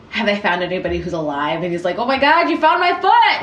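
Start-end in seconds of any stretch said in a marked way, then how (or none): 2.0-3.4 mock surprise. Cohost imagines the living owner, in mock delighted surprise. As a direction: play a man thrilled to find his lost foot. Man imagined finding his foot.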